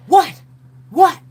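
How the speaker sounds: high, loud startled what. Retold or imitated. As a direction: blurt it in alarm. Howie startled.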